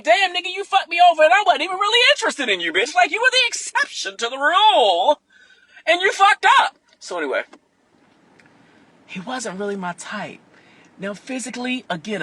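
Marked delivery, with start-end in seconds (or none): 0.0-6.8 loud, high, fast mock rant at him; imagined angry rant.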